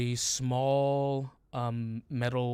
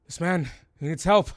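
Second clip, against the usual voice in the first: loud, urgent call for help; guard calling for help; 5 semitones higher, 10 dB louder than usual. Acted. A guard calls out for help for a wounded man, loud and urgent. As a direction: shout it out so the helpers hear. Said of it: "the guard goes".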